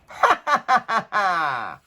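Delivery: high, drawn-out giggling fairy laugh; fairy's cackle. A narrator's reading. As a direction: laugh off the knights playfully. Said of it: "with a laugh".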